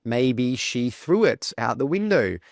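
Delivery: usual speaking voice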